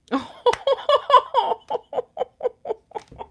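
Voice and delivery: long, high, helpless laughter; real laughing fit. Real, not acted. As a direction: crack up and keep laughing. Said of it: "I think I'm laughing".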